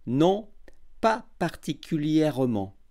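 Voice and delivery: every syllable clear and unhurried; over enunciating. Shown as a demonstration. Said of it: "j'articulerai bien et je parlerai lentement".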